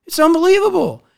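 high, loud burst of real amazement; excited exclamation. Real, not acted. Speaker marvelling to viewers, high and loud. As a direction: let the word explode upward with delight.

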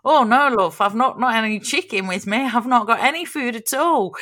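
high, loud imitation of a baffled Scotsman; Scottish dad. Dad answering the check-in lady, imitated baffled Scotsman. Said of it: "being a Scotsman's looking and he's not quite sure".

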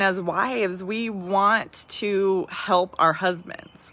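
usual speaking voice

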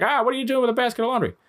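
high, fast, eager dog voice; the dog bouncing round your feet; excited dog voice. Retold or imitated. Dog getting in the way of the laundry, high and eager. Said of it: "he's like".

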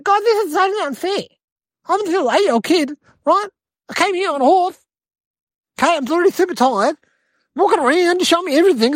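put-on high-pitched aggrieved kid; whining protest. Tired eight-year-old protests unfair punishment, whining. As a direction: complain high, piling up excuses.

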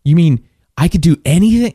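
high-pitched, disbelieving question; incredulous insistence. Retold or imitated. Freed girl presses her buyer about freedom, incredulous. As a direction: imitate someone pressing to be sure, voice climbing.

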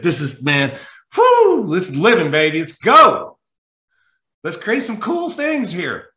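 high, loud whooping hype; pumped up. Retold or imitated. Speaker hyping himself up before calling the airline, loud and high. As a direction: cheer yourself up like a coach before a game. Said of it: "So I got legitimately pumped".

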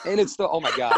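laughing. Real, not acted. Laughs at her morning voice.

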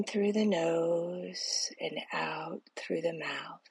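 slow, soft whisper, guiding each breath; whispered guided meditation. Acted. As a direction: breathe the words out slowly, barely voiced.

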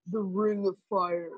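a lightly voiced demonic man, slowed down; hint of an ancient shamanic voice announcing the head; possessed man's voice. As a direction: intone it like a spirit speaking through you, a touch low and slow. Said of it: "like, a man's demonic voice".

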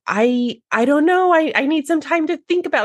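high-pitched, hesitant client impression; mock flustered. Client stalling after seeing designs, in a high flustered voice.

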